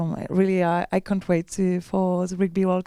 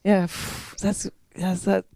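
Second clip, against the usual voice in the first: usual speaking voice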